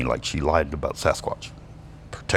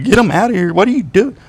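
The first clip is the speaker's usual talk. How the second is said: loud, high-pitched panicked order; high, alarmed mock yelling; mock outrage; 7 dB louder than usual. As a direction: imitate a manager freaking out at a dog. Acting out the outraged manager.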